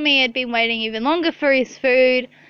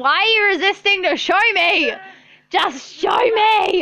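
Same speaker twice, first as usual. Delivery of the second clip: demanding shout; 6 semitones higher than usual. Real, not acted. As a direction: draw out each word, demanding. Demanding to see Olivia's screen.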